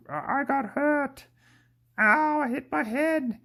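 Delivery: high, slow whiny hurt voice; hurt little voice. Pretend. Vlogger voicing his hand puppet after cutting his hand, high whiny hurt voice. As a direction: whimper like a puppet that bumped its head. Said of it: "like, like a".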